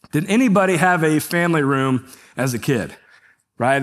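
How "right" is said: usual speaking voice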